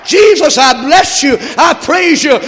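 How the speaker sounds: loud, high climactic praise; preacher shouting praise to close the sermon; full-force preaching. Acted.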